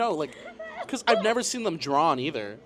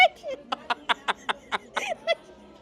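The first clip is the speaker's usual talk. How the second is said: long high giggle; bubbling laugh. Real, not acted.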